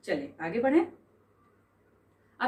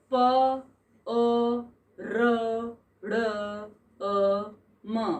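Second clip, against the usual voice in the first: very slow, each letter pulled apart; spelled out letter by letter; much slower than usual. Shown as a demonstration. Teacher spelling a Sanskrit word for the class, letter by letter, very slowly. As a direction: lay out the letters one at a time with gaps. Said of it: "वर्ण संयोजनम कृत्वा".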